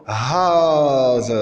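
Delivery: long drawn-out HAZ with a buzzing z; demonstrated pronunciation. Shown as a demonstration. Teacher showing how S sounds before a vowel, demonstrated pronunciation. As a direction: model "haz" slowly, stretching the vowel. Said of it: "comme haz".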